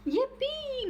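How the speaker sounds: high, gleeful squeal of joy; excited cheer. Acted. Alfie cheering that his grumpy face could stick, high squeal. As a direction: squeal it like a delighted kid. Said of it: "meowed Alfie".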